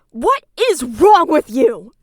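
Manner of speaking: loud, furious demand; angry outburst. Real, not acted. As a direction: yell it in real outrage at her. Furious rant at Susie.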